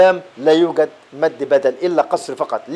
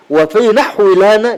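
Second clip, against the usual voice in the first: slow recitation; 4 semitones higher, 5 dB louder, slower than usual. Shown as a demonstration. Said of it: "نحو لنا يعني إيه".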